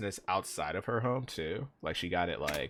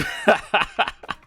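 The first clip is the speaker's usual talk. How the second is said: high burst of laughter; 9 dB louder than usual. Real, not acted. Laughing at own impression.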